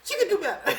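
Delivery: high, loud mock upset, breaking into laughter; hammed-up indignation with the pitch pushed up; retold upset outburst. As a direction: imitate his worked-up protest, half laughing. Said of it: "He was really upset".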